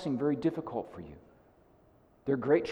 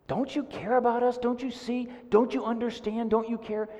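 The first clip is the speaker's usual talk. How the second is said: high, pleading voice; panicked plea; 10 semitones higher than usual. Retold or imitated. Disciples retold begging the sleeping Jesus, high pleading. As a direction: beg to be noticed, frantic.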